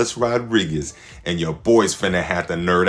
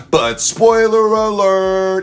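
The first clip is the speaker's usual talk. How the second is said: slow, booming high announcer voice; big slow mock spoiler warning; dramatic announcer; 12 semitones higher, 10 dB louder, much slower than usual. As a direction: announce it like a movie trailer.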